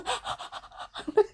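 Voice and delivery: squeaky breathless laugh; high laugh. Real, not acted. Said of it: "we were laughing".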